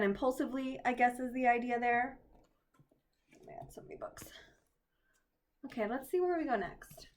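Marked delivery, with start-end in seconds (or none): 3.4-4.2 breathy whispered aside; whispering. Real, not acted. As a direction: whisper it to yourself. Muttering aside while reading.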